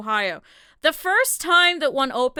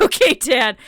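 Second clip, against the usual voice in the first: louder, mock fed-up okay; loud exasperated reply; 9 dB louder than usual. Answering her stubborn dad loudly and fed up. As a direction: call back to dad.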